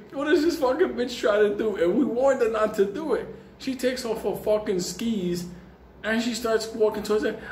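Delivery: ordinary talk, nothing marked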